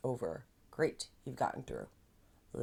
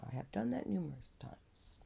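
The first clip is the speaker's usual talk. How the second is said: whisper; 5 semitones higher, 6 dB quieter than usual. Real, not acted. Confiding aside.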